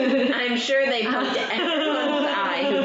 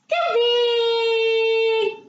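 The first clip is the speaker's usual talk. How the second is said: high happy shout; 12 semitones higher, 4 dB louder than usual. Real, not acted. Toddler game with baby.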